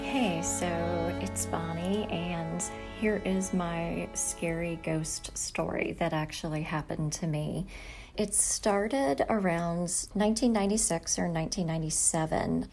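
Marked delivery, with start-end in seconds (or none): none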